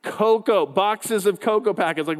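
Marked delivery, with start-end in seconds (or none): none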